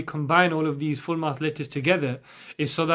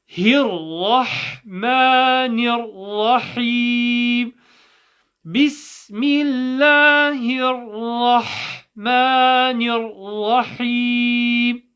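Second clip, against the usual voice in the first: higher, sustained chanting tone; Quranic recitation; 7 semitones higher, 5 dB louder, much slower than usual. Shown as a demonstration. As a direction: recite slowly with long held vowels. Recites the Tasmiyah.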